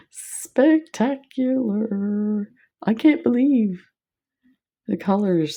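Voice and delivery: dazzled gush; awestruck. Real, not acted. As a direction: draw it out in amazement. Awed at bead colors.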